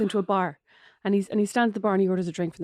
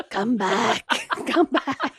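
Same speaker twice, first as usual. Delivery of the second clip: creepy Samara; 2 semitones higher, much slower than usual. Pretend. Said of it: "crawling along the ground like Samara from The Ring".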